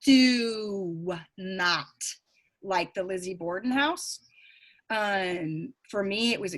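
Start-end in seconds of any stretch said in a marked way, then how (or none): 0.0-1.8 "do not" stretched long after a pause; emphatic DO NOT. Real, not acted. Medium stresses dislike of the house, drawn-out "do not". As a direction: draw out "do" heavily, then a firm "not".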